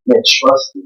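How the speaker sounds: hushed, breathy trust me; soft reassurance. Retold or imitated. God quietly reassuring Moses, hushed. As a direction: whisper it like a promise.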